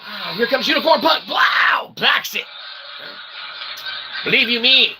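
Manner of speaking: ordinary delivery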